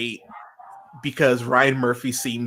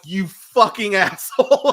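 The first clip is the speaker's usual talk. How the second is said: higher, louder outraged curse through laughter; laughing mock yell; 8 semitones higher, 6 dB louder than usual. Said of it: "I was yelling at the TV".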